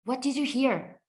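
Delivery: ordinary talk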